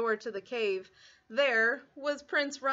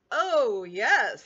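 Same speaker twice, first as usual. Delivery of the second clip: louder, boastful oh, yes; proud dragon; 8 dB louder than usual. Acted.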